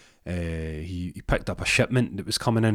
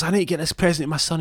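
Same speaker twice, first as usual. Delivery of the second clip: rushed, high, louder gabble; fast high excuse; 10 semitones higher, 5 dB louder, faster than usual. Retold or imitated. Rory gabbling an excuse to Hernandez, fast and high. Rory's hurried excuse voiced.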